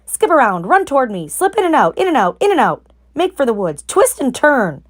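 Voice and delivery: high rapid-fire commands; urgent orders. A narrator's reading. Goose shouting instructions to Wilbur, excited. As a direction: coach him through the chase. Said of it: "the goose took command".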